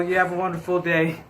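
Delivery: usual speaking voice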